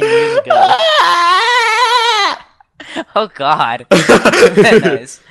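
shrieking with laughter; laughing. Real, not acted. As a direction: laugh through the words. Bursts out laughing at joke.

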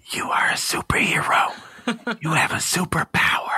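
put-on secret whisper, as into a small child's ear. Shown as a demonstration. Dad telling his little kids what he whispers in their ears, whispered. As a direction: whisper it like a belief to plant. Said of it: "I just whisper".

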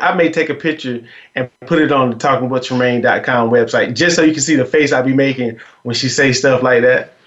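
ordinary talk, nothing marked

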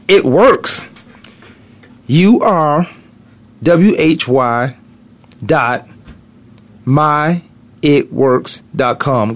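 slow, deliberate spelling of the address, letter by letter; host plugging his website to listeners, slowly spelling it out; spelled-out web address. Shown as a demonstration. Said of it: "spelling out his web address".

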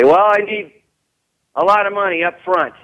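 squeaky put-on voice of a creditor who wants cash; demanding creditor. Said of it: "And they say".

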